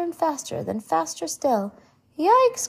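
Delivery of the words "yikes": high, loud yelp; little boy's excited squeal of fright; startled cry. A narrator's reading. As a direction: cry out in sudden alarm, pitch jumping up. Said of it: "cried Billy".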